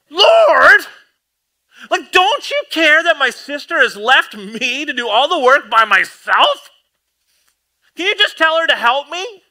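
a fed-up sister pleading; exasperated Martha. Retold or imitated. Martha complaining to Jesus.

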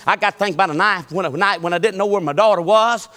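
normal talking voice

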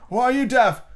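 high, loud indignant frog; affronted old frog; old frog arguing with Alice. A narrator's reading.

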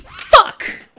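a sudden high yelled curse; frustrated outburst. Real, not acted. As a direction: blurt it out in sheer frustration. Sudden outburst at seeing Quibi.

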